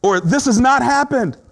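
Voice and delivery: high, loud disbelieving protest; high loud voice of someone in denial; voicing denial. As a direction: refuse to believe it, pleading and insistent. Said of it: "This stage is when you say".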